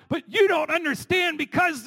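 mock a whiny protester stamping his foot; whiny ranting. Preacher mimicking someone ranting in an argument, whiny and high. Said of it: "have you ever talked like that?".

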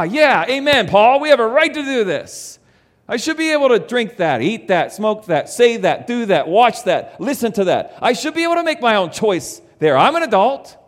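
loud, high, fired-up insistence; rah-rah cheering. Retold or imitated. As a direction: cheer on your rights like a rally crowd. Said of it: "Do you feel kind of the rah, rah?".

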